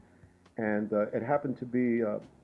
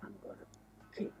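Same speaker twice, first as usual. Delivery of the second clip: soft hoarse whisper, much quieter; Miles's whispery voice; 15 dB quieter, much faster than usual. Retold or imitated. Miles calling at 2:30 am, whispered and hoarse. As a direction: rasp it low and secret down the phone. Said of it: "it picks it up and goes".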